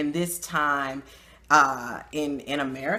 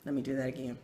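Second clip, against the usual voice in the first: ordinary talk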